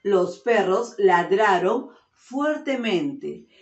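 slow clear repeat. Shown as a demonstration. Teacher repeating the sentence to attentive pupils, slow. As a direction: read it again slowly, the last word drawn out. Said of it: "Escuchen atentos".

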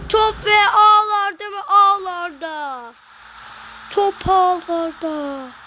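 screaming football commentator. Acted. Goal call commentary.

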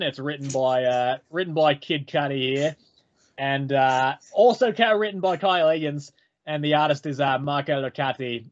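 a light put-on Australian accent; lightly done Australian accent. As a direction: say it like a casual Aussie mate. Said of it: "talking in this Australian accent".

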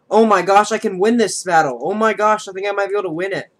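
gasping, high and loud; thrilled disbelief, rushing the words; excited. Real, not acted. Realizing he can win.

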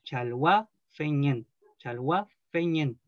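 chalwa fe ñen split with small pauses between parts; slow, pause between chalwa, fe and ñen. Shown as a demonstration. Teacher shows the class the pauses in chalwa fe ñen. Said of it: "como hacer esas pausas".

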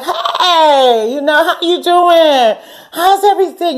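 high, slow, laughing sing-song hello; friendly greeting. Retold or imitated. To the old friend, a high laughing friendly hello. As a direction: greet her sweetly, a little over the top.

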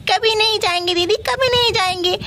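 a hint of little kids pleading; child voice. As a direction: kids promising their sister, childlike. Kids promise their sister.

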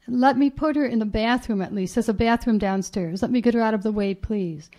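usual speaking voice

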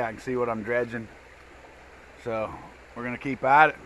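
ordinary delivery

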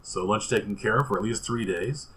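ordinary talk, nothing marked

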